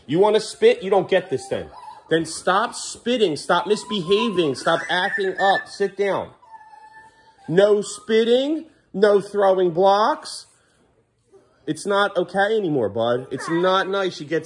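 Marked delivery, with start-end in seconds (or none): 0.0-6.3 stern scolding. Real, not acted. Dad scolds spitting toddler. 7.5-10.5 higher, slow, every word laid down firmly; stern slow scolding. Real, not acted. Dad sets rules for spitting son, stern and slow.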